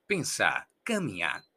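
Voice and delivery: "pensar, caminar" low, each ending on a bare a; "pensar, caminar" as "pensá, caminá"; silent final R. Shown as a demonstration. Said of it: "no la pronunciamos".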